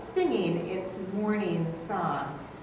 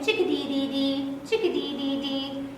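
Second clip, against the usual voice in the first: chickadee call imitation; 3 semitones higher than usual. Shown as a demonstration. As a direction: chirp the name like the bird. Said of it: "So he'll say his name".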